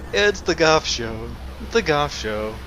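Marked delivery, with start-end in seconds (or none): 0.1-1.3 slow, drawn-out showman intro; announcer voice. Pretend. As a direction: introduce it like a TV host. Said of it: "I don't know where I was going with that".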